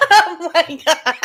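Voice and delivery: loud, slow laughing exclamation. Real, not acted. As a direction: let it spill out through laughter. Laughing loudly.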